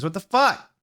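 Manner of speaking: high, sharp angry yell; angry outburst. A narrator's reading. James yelling at Lucian for playing wrong. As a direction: snap at the mistake. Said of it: "yielded James".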